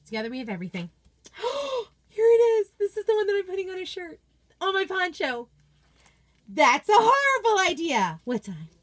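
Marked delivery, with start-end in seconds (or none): 1.3-1.8 sharp, high gasp of delight; big excited intake of breath. Real, not acted. Delight at spotting favourite design. 2.1-5.5 high, loud excited burst; pitched up, bursting with delight; thrilled discovery. Real, not acted. Finds the design she loves. 6.5-8.7 loud, high dramatic read; big swooping emphasis on horrible, then drop; gleeful exclamation. Acted. Reads design title with gusto.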